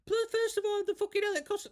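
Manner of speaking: indignant rant about bar prices that leaps up into a squeak; high-pitched outrage. Real, not acted. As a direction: voice shooting up very high and loud. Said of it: "did you see how high pitched my voice went then".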